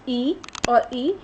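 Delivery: ई और ई stretched out slowly; slow vowel demo. Shown as a demonstration. Teacher joining two vowels, said slowly. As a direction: draw out each ई for the students. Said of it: "इन दोनों को हमने जमा कर दे".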